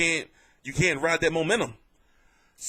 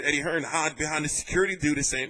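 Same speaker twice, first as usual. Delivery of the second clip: normal talking voice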